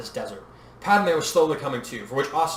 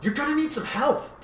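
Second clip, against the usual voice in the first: high-pitched insistence; pitched up, pressing appeal; insistent; 12 semitones higher than usual. A narrator's reading. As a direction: press the point firmly. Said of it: "Padme insisted".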